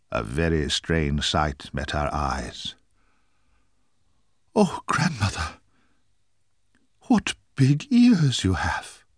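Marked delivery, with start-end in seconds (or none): 4.5-9.0 put-on high wondering girl voice, slowly; a little girl gaping in wonder; amazed little girl.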